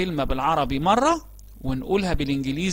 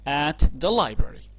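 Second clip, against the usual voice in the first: slow model phrase; much slower than usual. Shown as a demonstration. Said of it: "عنوان الوحدة بتاعتنا".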